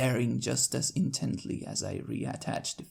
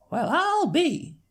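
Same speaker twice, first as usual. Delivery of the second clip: slow, higher country mare in awe; older sister marveling, much higher and slow; marveling Applejack; 7 dB louder, slower than usual. Acted. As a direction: let the wonder drawl out.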